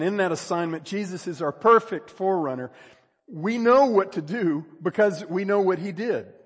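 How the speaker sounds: ringing preacher's emphasis; full-force preaching. Real, not acted. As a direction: declare it firmly from the pulpit.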